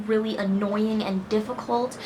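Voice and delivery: normal talking voice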